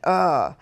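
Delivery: a fed-up groan of disgust; hammed-up annoyed ugh; retold ugh. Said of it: "kinda rolled your eyes and went".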